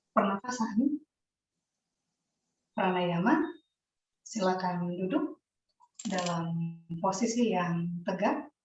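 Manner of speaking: soft, measured instructor voice with long pauses; guided yoga instruction. Real, not acted. Yoga teacher settling the class into seated breathing practice, softly guiding. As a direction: guide them gently into position, pausing between phrases.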